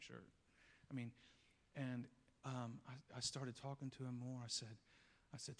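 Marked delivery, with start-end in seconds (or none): none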